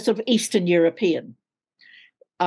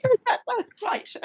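high-pitched, gushing, half laughing; real squeal of delight at the compliments; overwhelmed delight. Real, not acted. Actress touched by a fan comment, high delighted gush.

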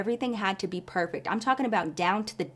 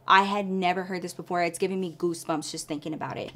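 ordinary talk, nothing marked

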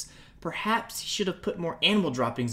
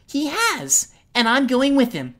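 higher, louder, firm and crisp; crisp reply; 7 semitones higher, 8 dB louder than usual. Acted. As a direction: shut him down crisply. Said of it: "Saria replied crisply".

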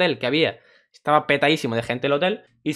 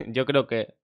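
usual speaking voice

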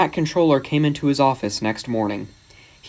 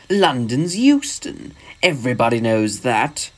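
slow, drawn-out disdain; scornful snort. A narrator's reading. Visiting engine correcting Gordon about London, scornful. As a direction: correct him as a haughty engine. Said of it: "snorted the engine".

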